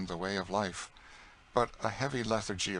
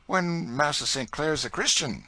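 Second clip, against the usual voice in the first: higher, louder earnest answer; Tom's earnest voice; 8 semitones higher, 6 dB louder than usual. Acted. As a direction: answer with simple conviction. Tom answers his master.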